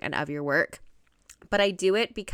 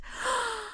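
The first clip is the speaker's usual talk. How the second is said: real sharp gasp of surprise; sudden amazed intake of breath; astonished gasp; 7 dB quieter than usual. Real, not acted. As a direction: gasp as the news sinks in. Shocked at forgotten anniversary.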